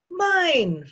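put-on high, loud little-kid voice; possessive toddler. A narrator's reading. Toddler clutches the book, loudly possessive. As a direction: claim it like a stubborn small child.

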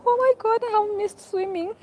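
high, excited squeal of longing; overjoyed squeal. Real, not acted. Vlogger gushing to viewers about missing swimming, high squeal. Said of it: "I'm about to cry".

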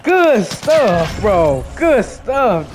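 loud, high excited cheer. Real, not acted. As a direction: hype up your teammate. Cheering a teammate's clutch.

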